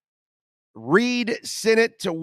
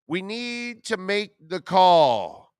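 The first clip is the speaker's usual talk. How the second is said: slow dramatic summons; 3 semitones higher, slower than usual. Acted.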